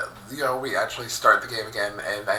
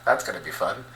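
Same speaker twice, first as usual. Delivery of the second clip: ordinary delivery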